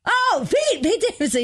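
very high, gushing fake recognition; mock excited greeting. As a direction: imitate a castmate suddenly thrilled to see him. Imitating suddenly friendly castmates.

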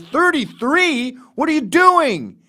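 mock exasperation. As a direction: stretch it out in disbelief.

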